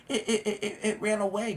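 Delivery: put-on stammering kid. Boy nervously telling his dad it ran away, stammering. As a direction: stumble over it like a nervous kid.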